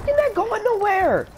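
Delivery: bait the enemy with swagger; hyped taunt. Pretend. Gamer taunting enemy players, high swaggering greeting.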